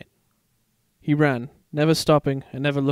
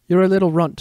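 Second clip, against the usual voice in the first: teasing; 4 semitones higher, 6 dB louder than usual. Acted. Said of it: "Simba teased".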